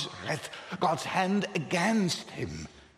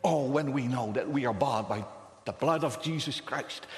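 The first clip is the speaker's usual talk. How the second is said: ordinary talk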